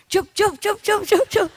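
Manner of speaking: high quick chup chup pecking noises; high clicking pecks; bird pecking sounds. Retold or imitated. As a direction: mimic a bird gobbling seed. Imitating bird eating.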